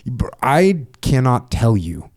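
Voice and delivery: ordinary talk, nothing marked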